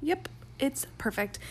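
usual speaking voice

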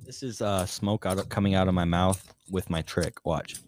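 quiet close-mic murmur; soft ASMR voice. Shown as a demonstration. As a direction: hush it low into the mic. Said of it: "A S M R".

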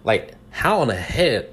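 ordinary delivery

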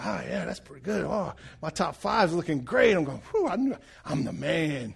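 lightly voiced cocky gloating; smug self-praise. Preacher retells reading his own test results, smug. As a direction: pat yourself on the back.

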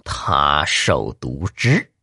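very slow, low, each syllable set apart; word by word. A narrator's reading. Poisoner names the poison to the victim, word by word. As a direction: spell it out one syllable at a time, low. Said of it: "秋雨一字一顿地说道".

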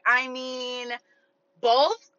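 drawn-out reluctance, then a loud questioning rise; stall, then offer it as a question; acted awkward honesty; hesitant answer. Acts out her reluctant answer.

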